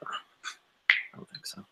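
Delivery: whispered. Real, not acted. Declining the suggestion.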